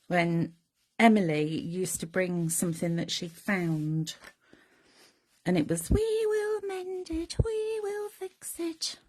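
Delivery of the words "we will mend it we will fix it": very high, tiny cartoon mouse voice; squeaky little mice. Remembering the mice fixing things, in a tiny squeaky voice. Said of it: "That's what the little mices used to do".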